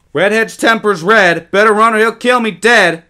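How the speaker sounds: put-on high, loud singsong taunt of a boy; mocking taunt. A narrator's reading. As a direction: jeer it like a playground rhyme. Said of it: "mocked George".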